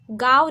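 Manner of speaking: गाँव wrongly said with an oral आ and a clear व; no nasal sound. Shown as a demonstration. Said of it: "नहीं". (गाँव को बिना नाक के, गाव जैसा)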